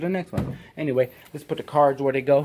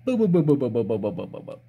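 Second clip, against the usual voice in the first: boops falling and fading away; faster than usual. Pretend. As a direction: let the boops die off.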